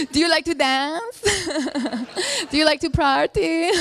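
lightly voiced party lover, slightly high; playful mock come-on with laughs between; party-loving Persian. Persian asks a stranger to dance and party, lightly voiced flirty impression.

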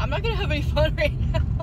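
high, fast, laughing in disbelief; dreading the new ID photo, laughing loudly; laughing dismay. Real, not acted. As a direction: laugh it off in panic. Laughs through her dismay.

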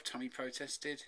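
ordinary delivery

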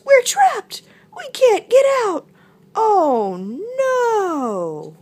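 high, loud mouse squeal; squeaking mouse. A narrator's reading. Mouse trapped with frog, loud panicked squeak. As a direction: squeak out the alarm at full pitch. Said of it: "squeaked Mouse".

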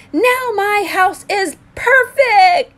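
high, loud and slow with joy; cheering. A narrator's reading. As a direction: cheer it out, delighted. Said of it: "cheered Hermit Crab".

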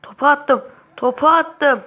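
loud triumphant shout; excited cheer. Real, not acted. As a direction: celebrate the shot you just took. Celebrating a shot.

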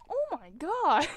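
high, loud shocked cry; loud exclamation. Real, not acted. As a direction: burst out in disbelief. Reacting to death.

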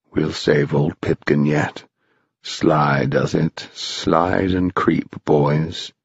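low, slow conspirator; slow it down, low and stealthy; low creeping undertone; sly caution. A narrator's reading. Mound Shroud cautioning, creeping away.

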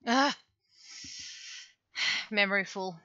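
ordinary talk, nothing marked

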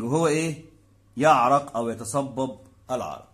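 ordinary delivery

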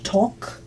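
"talk" pronounced with no L, like "tok"; silent L. Shown as a demonstration. Teacher showing how the verb is pronounced, "talk" with silent L. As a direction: softly model "talk", leaving the L silent. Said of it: "y se pronuncia".

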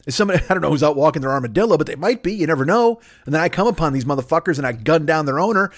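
ordinary delivery

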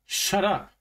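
angry growl pitched high. A narrator's reading. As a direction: growl it as a high, sharp retort. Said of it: "Suzuki growled".

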